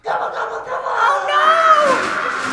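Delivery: alarmed cry. Acted. Au pair watches the house wrecked, alarmed cry. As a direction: cry out as it falls.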